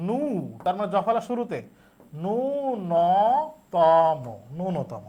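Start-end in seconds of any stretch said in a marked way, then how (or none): 0.1-0.2 নু held long as "nuuu"; drawn-out syllable. Shown as a demonstration. Teacher models the word's first syllable, নু drawn out long. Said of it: "আমাকে বলতে হবে কি". 2.2-4.2 ন্যূনতম very slowly, nuu-no-too-mo; syllable by syllable. Shown as a demonstration. Teacher models correct form for students, ন্যূনতম slowly broken into long syllables. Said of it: "আমাকে বলতে হবে কি".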